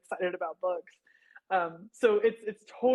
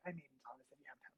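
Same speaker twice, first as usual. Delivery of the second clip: rushed, softer whispered self-introduction; fast nervous whisper; 8 semitones lower, 20 dB quieter, much faster than usual. Retold or imitated. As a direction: hurry it out under your breath, embarrassed. Shyly introducing self to bookseller.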